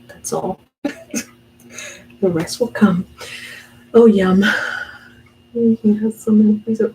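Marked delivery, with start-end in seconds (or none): 3.9-6.9 loud, eager yum; light up at the sight of food; delighted yum. Real, not acted. Seeing lasagna she loves, a loud delighted yum.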